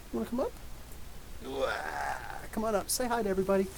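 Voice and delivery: high, gentle pet-talk; coaxing a pet. Real, not acted. Inviting Bagel up to say hi, coaxing pet-talk.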